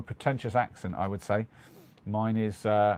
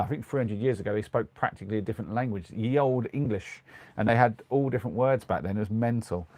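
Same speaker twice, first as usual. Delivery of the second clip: ordinary talk, nothing marked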